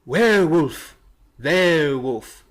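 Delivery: drawn-out, put-on comic delivery; odd movie-quote voice. Acting out an old werewolf film gag, odd voice. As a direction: stretch each word in a silly put-on voice. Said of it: "Why are you talking like that?".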